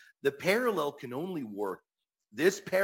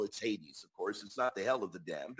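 usual speaking voice

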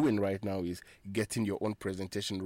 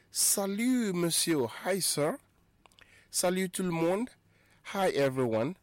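high model greeting; 9 semitones higher than usual. Shown as a demonstration. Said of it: "teaching greetings".

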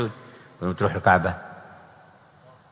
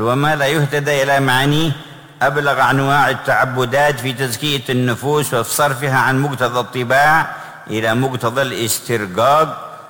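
higher, louder fervent reading; forceful preaching; 6 semitones higher, 5 dB louder than usual. Real, not acted. Reading quoted text forcefully.

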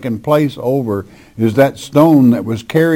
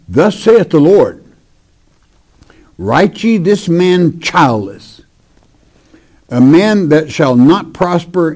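preacher reading judgment; solemn scripture reading; 4 semitones higher, 4 dB louder than usual. Acted. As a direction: pronounce the prophecy with weight.

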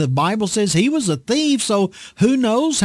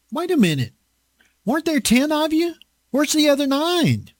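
Jesus asking; 7 semitones higher than usual. Retold or imitated. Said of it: "I can just envision Jesus saying".

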